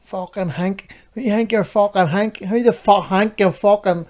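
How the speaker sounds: high, mock-aggressive Northern Irish lad; Northern Irish imitation. Imitating the boy confronting Tesco staff, Northern Irish accent. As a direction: imitate Northern Irish speech with "Hank" for think. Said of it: "he said Hank".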